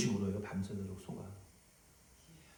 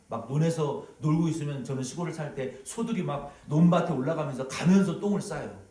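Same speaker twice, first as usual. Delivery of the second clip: ordinary delivery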